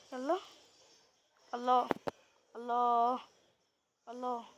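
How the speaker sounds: loud delighted cries of Allah; loud happy exclamation; excited cheer. Real, not acted. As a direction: cheer out at a great move. Cheering a good move.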